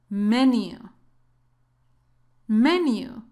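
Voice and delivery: usual speaking voice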